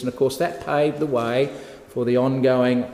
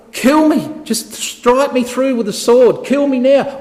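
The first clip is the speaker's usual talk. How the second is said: very high, loud desperate begging; pleading to die; 11 semitones higher, 6 dB louder than usual. Acted. Wounded king begs his armour bearer to kill him, loud and high. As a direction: beg for the sword, frantic and high.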